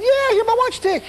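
joyful high-pitched answer; delighted. Retold or imitated. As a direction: say yes like it's a miracle. Healed man answering joyfully.

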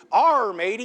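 loud mock pirate "Arr"; pirate greeting. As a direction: hail them like a pirate. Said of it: "if I'm in a pirate costume".

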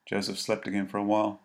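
usual speaking voice

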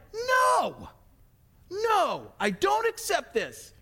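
high whiny shouted no, then winding down; mock two-year-old refusing, hammed-up; toddler tantrum. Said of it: "that two-year-old temper tantrum".

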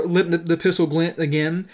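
ordinary talk, nothing marked

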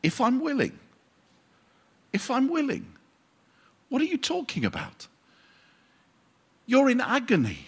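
high, incredulous and offended; an offended Jesus, pitched high and exasperated; indignant Jesus. Retold or imitated. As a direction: voice Jesus as put out by the question. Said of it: "it has to have been something like this".

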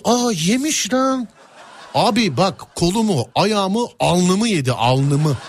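high, slow "Aa, yemiş lan"; surprised dismay. Retold or imitated. Finding mosquito bites on his arm, slow shocked dismay.

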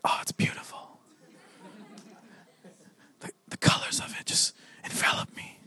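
breath-only mock-reverent whisper; whispered admiration. Gallery visitor answering a whispered question, awed whisper. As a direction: whisper it as if overcome by the art. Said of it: "someone comes in and whispers".

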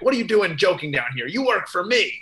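mock-annoyed boss, pitch climbing at the end; pissed-off boss. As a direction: play Mister Terrific telling off an employee. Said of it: "everyone's pissed off at Wally".

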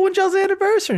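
high, perky chirp; perky question. Retold or imitated. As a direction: ask like an eager cashier. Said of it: "she's like".

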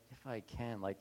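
low, soft disbelieving echo; exasperated Jesus. Retold or imitated. Jesus echoing the father's doubt, low and soft. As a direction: repeat his words back with weary disbelief. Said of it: "He's like".

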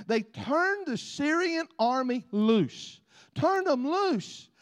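slow, rising preacher emphasis; preaching. Acted. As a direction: drag it out from the pulpit.

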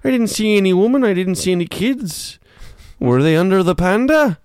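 loud, high put-on character voice; storyteller from Jaws. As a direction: plead your case in a loud, high tall-tale voice. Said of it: "You sound like you're from Jaws".

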